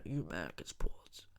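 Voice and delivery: a breathy unvoiced whisper. Ranting vlogger muttering an aside to viewers, whispered. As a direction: let it slip under the breath.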